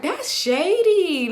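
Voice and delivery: slow, high, pointed disapproval; drawn-out judgment. Retold or imitated. Girl in the car judges the friend, slow high disapproval. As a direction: drag it out like calling someone out.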